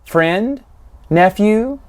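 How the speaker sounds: drawn out, rising on each word; questioning. Acted. Quoted line, questioning rise on each word.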